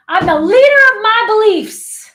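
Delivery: loud, high power declaration; real strong. Shown as a demonstration. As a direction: belt it out like a strong woman. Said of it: "go in real strong".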